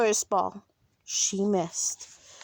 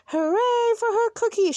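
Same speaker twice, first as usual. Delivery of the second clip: high, loud team hooray; cheering shout; 11 semitones higher, 5 dB louder than usual. A narrator's reading. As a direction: cheer like a gang of happy boys. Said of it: "shouted the boys".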